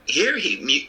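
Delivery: ordinary delivery, nothing marked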